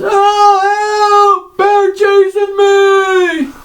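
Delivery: put-on high panicky kid, dragging out the cries; fluttering cries for help. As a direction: flail out a high yelp for help.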